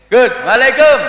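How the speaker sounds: very high, loud shouted salam; brash student greeting. Acted. Santri meeting Gus Heri, brash high shout. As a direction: yell it at the teacher as if at a pal. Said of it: "ana santri ketemu Gus Heri".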